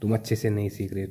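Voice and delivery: ordinary talk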